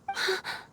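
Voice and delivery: a quick high gasp of surprise; sharp gasp. Real, not acted. Girl startled by her own outburst, gasping. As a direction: suck in air, startled. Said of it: "I got a bit too excited".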